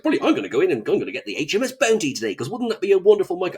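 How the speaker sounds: put-on fast, eager rush of a hopeful kid; excited kid. Young fan retelling his catalogue plan, fast and eager. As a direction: gush like a boy planning a treat.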